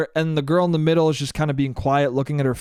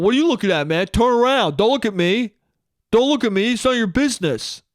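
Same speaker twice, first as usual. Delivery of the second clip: higher-pitched mock indignant kid; hammed-up defensive challenge; confrontational kid; 5 semitones higher than usual. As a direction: imitate a youngster snapping at a gawker. Acts out kid telling him off.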